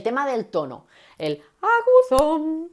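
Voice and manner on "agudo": high voice. Shown as a demonstration. Teacher demonstrating a high voice to the class. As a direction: jump up into a high voice. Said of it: "de repente subo el agudo".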